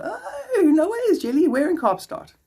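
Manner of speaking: high-pitched squeal of surprise; excited amazement. Real, not acted. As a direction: squeal it like delighted news. Amazed at viewer living nearby.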